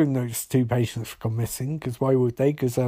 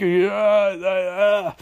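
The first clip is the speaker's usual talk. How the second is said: strained, burned-throat grunts, a touch higher; voiceless croak; 3 semitones higher, much slower than usual. Retold or imitated. Recapper voicing a burned-throat killer, croaking uh uh uh. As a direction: imitate a mute man struggling to speak. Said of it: "his vocal cords have been burned".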